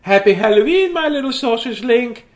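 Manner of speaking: high gushing mother's greeting; doting mother. A narrator's reading. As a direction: greet your pup like a gushing mom. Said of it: "said Oscar's mother".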